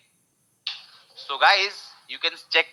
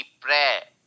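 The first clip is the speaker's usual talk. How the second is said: ordinary talk, nothing marked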